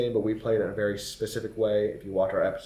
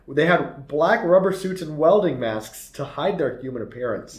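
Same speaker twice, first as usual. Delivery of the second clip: usual speaking voice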